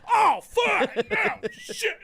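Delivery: very high, loud mock shriek of disgust; the two guys shrieking in hammed-up horror, very high. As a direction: squeal like you've been shot. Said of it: "They were like".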